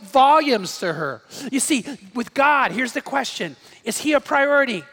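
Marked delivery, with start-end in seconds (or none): none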